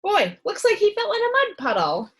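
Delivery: doctor joking about the mud, loud and high; jolly doctor. A narrator's reading. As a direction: tease the boy cheerfully.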